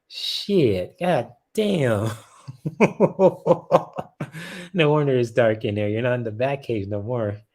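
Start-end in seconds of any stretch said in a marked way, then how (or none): 2.5-4.7 loud, high burst of laughter; helpless high cackle; real cackle. Real, not acted. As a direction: crack up in a long high laugh. Cracking up at the card.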